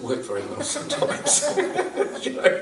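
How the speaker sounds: amused explanation broken by real laughs; laughing while talking. Real, not acted. Speaker explains Swami's uncomplimentary screw loose remark, laughing. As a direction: chuckle through the words.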